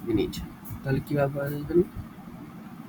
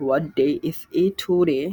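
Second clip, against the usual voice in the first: slow, careful classroom English; slow drill; slower than usual. Shown as a demonstration. Teacher models a question for students, slowly. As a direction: spell it out slowly for beginners. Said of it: "slow drill for learners".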